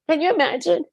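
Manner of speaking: pleading through tears; real crying, voice pushed up. Real, not acted. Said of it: "with tears".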